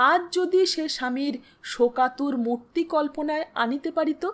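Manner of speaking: ordinary delivery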